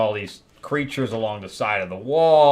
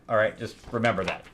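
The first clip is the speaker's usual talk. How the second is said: normal talking voice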